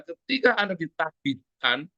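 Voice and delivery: ordinary talk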